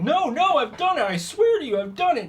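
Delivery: very high, loud pleading; desperate insisting. Acted. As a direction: beg them to believe you, voice cracking high. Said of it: "he's insisting that this is true".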